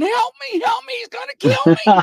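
very high, loud imitated screaming for help; mock cry for help. Officer imitating the crawling vagrant calling to his buddies, high panicked cries. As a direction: wail for help like he is fleeing a killer.

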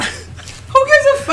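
laughing shout. Real, not acted. Friends cracking up over the Spanish class, a high laughing yell.

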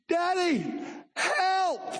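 high frightened call for daddy; high scared child calling for help; scared child cry. Retold or imitated. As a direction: cry out like a scared little kid.

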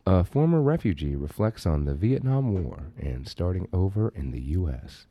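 low, measured public-radio delivery; mock NPR presenter; NPR news read. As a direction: read a sober news teaser, low and unhurried. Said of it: "Give me some news to read".

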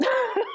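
high burst of laughter; amused high giggling laugh; real laugh. Real, not acted. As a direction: crack up mid-summary. Cracks up while starting her summary.

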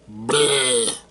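loud retching "bleh" of disgust; loud, revolted groan. Real, not acted. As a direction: blurt out a yucky noise. Disgust at watching Morbius.

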